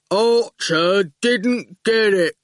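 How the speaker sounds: very slow stubborn goon. A narrator's reading. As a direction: stress didn't, dragged out heavily. Goon repeats himself.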